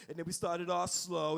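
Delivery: normal talking voice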